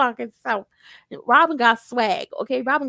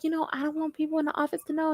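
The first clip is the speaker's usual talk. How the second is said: hushed confiding; 3 semitones higher, 7 dB quieter, faster than usual. Retold or imitated. She confides secretly.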